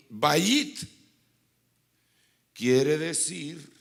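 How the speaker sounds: loud, slow preacher's reveal; loud teaching. Real, not acted. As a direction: announce it slowly and loudly. Enseñanza, muy fuerte y lenta. (revelación lenta y fuerte)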